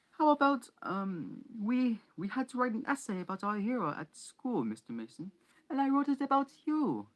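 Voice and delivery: high, loud, hammed-up English accent; bad English accent. A narrator's reading. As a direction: overdo a posh English accent badly. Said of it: "That's a terrible English accent".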